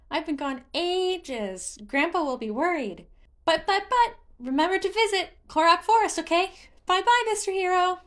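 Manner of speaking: a chirpy little creature showing off; singsong Hestu voice. A narrator's reading. Hestu speaks, voiced character.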